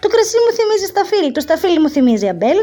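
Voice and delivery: fast, high, speeding up chain of thoughts; boy rattling off. Acted. Boy's chain of associations. (παιδί τα λέει όλο και πιο γρήγορα)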